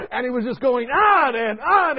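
ordinary talk

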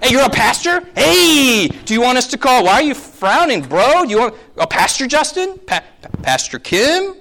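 high, hammed-up incredulous buddy voice; imitated puzzled churchgoer, high and emphatic; casual American churchgoer. American churchgoers casually question pastor's title.

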